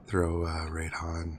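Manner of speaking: breathy low whisper. Real, not acted. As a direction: hiss the advice under breath. Whispered suggestion during stream.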